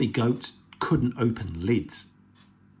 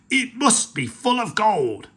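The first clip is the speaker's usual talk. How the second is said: pirate chief voice; 9 semitones higher, 5 dB louder than usual. Acted.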